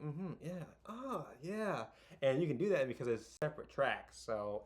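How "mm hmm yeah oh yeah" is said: affirming listener. Retold or imitated. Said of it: "hearing you say, like".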